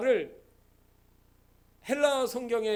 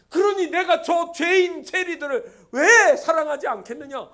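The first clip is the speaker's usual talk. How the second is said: loud, raised voice, hard punch on 왜; forceful protest; 8 semitones higher, 12 dB louder than usual. Acted. God protesting to the Pharisees about loving sinners, forceful protest. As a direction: argue back with full preaching force. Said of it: "하나님 지금 항변하는 거예요".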